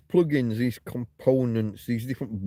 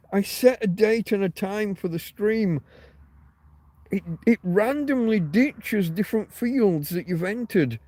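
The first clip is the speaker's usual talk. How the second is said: higher, exasperated rant about the stream settings; frustrated rant; 7 semitones higher than usual. Real, not acted. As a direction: vent the frustration, pitch up. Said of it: "You can tell by how I'm ranting".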